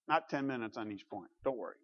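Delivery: normal talking voice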